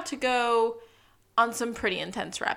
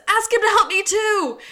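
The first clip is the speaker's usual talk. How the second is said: much higher and louder, pleading; frantic plea; 11 semitones higher, 8 dB louder than usual. Retold or imitated. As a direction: tag on a frightened plea of your own. This pandemonium.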